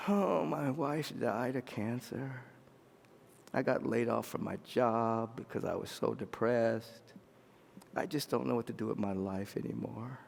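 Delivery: low, soft, broken-down husband; grieving confession. Acted. Voices grieving man confiding.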